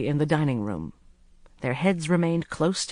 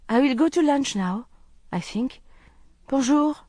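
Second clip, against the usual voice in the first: put-on higher, lightly accented man taking leave; politely ending the talk, Bonjour; Hafez, polite servant; 10 semitones higher, 4 dB louder than usual. A narrator's reading. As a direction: excuse himself politely and go. Narrator voices Hafez.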